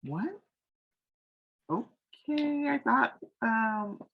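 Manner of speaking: high, slow, bewildered "what? okay"; confused surprise. Retold or imitated. Tressa realising she was friend zoned, high and bewildered. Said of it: "she's like".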